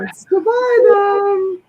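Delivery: high, slow, squealing mock-excitement; hammed-up eager friend; imitating Katie. Host imitating Katie eager to go buy, high and squealy. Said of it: "Katie's like".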